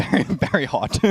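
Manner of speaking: talking past a hot sip; scalded tongue. Real, not acted. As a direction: speak with a burning-hot mouthful. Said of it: "Almost burnt my tongue".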